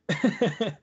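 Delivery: short nervous giggle; nervous laugh. Real, not acted. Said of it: "Me río por nerviosismo".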